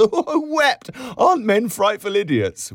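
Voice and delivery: high, loud, giggly exclamation; laughing. A narrator's reading. Barmaid laughing with her friend about men, high and loud. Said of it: "she laughed".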